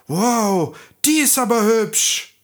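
put-on dwarf marvelling at the sleeping girl; delighted wow. A narrator's reading. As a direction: gush in wonder.

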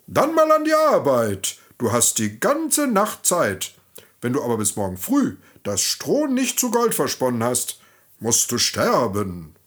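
stern king. Acted. The king locks the miller's daughter in with the straw, stern and commanding. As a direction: lay down the deadline like a harsh king. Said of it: "sprach streng".

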